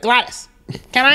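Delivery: a hint of a pleading kid; lightly voiced younger brother begging; mock little brother asking. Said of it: "be like".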